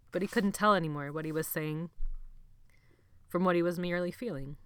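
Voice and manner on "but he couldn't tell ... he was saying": ordinary delivery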